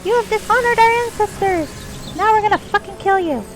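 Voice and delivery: high, loud, shrill pixie threat. Pretend. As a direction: squeak it out like an angry pixie. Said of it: "This is revenge for the pixie voice".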